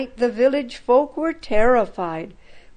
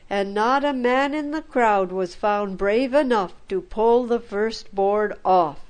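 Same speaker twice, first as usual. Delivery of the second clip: ordinary delivery, nothing marked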